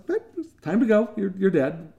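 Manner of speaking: high, cheery Grim Reaper; friendly Death. Retold or imitated. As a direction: chirp it up brightly like a kindly Death. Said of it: "death shows up and says".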